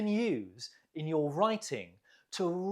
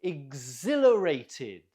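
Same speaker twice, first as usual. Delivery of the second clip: Exhilarated with ZIL stretched and louder; stress on ZIL; 2 semitones lower, 5 dB louder than usual. Shown as a demonstration. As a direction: punch the second syllable of Exhilarated. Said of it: "Note the stress on the zil".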